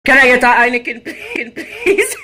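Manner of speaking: joking yell for a beer; laughing shouted order. Pretend. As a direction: shout the order over the counter, cracking up. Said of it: "repeats the order, louder each time".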